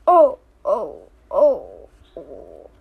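falling, hammed-up sobbing oh's; mock sobbing. Reader mock-crying over sad story.

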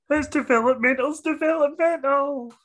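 high-pitched mocking take on a co-host's accent; Troy impression, funny accent. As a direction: put on a silly high accent to quote your co-host. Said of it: "as Troy would say".

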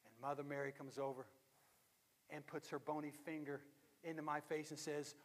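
ordinary talk, nothing marked

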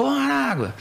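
high, stretched-out holler; imitated shout. The man in the stands calling out, imitated high shout. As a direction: holler a nickname across the stands, retold. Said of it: "Aí o cara gritou de novo".

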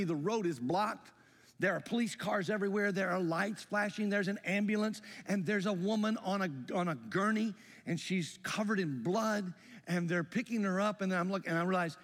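ordinary delivery

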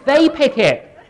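loud laughing repeat on they; loud, laughing emphasis; laughing punchline. Acted. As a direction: shout the punchline through a laugh. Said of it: "the Jehovah's Witnesses pick it".

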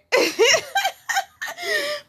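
a high-pitched burst of real laughter. Real, not acted. Laughing at her own creepy joke, high laugh.

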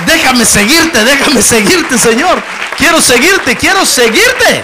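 loud, high pleading cry to follow the Lord; impassioned preacher's loud prayer; fervent loud plea. Real, not acted. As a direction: beg the Lord at full voice, slowing at the end.

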